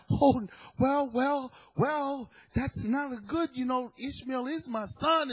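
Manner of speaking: high, hesitant protest; upset Abraham. Retold or imitated. Husband pleading for his son Ishmael, upset and hesitant. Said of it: "And Abraham is upset".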